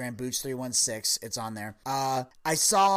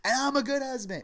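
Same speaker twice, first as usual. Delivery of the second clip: higher, proud mock boast; proud husband; 10 semitones higher than usual. Tom Arnold bragging in his closing bit, mock proud. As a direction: brag about the save, lightly hammed. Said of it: "He goes".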